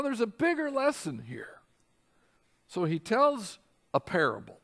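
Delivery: usual speaking voice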